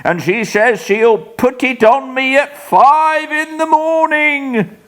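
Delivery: high, frightened ghost-seer voice; a sick man babbling in terror; terrified raving. Acted.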